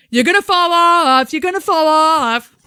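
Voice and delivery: loud high taunting yell; jeering shout. Retold or imitated. Teen boys taunting a unicyclist, loud high jeer. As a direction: jeer at him loudly. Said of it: "Loud noises".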